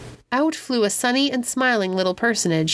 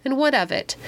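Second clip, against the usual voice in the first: higher-pitched, slightly testy retort; mildly ill-humored; 6 semitones higher than usual. A narrator's reading. Epimetheus answers Pandora about the voice, mildly ill-humored. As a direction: answer with a touch of grumpiness. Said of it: "in no very good humor as of yet".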